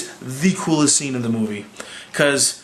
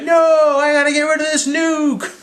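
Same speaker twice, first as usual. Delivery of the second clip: much higher and louder urgent no; urgent protest; 13 semitones higher, 3 dB louder than usual. Retold or imitated. Tony refusing to close the portal, with a high urgent cry. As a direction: cry out in a high voice to stop her. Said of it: "And Tony's like".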